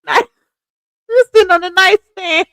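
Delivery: high-pitched giggly words; cracking up at own joke; laughing. Real, not acted. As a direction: barely get it out for laughing.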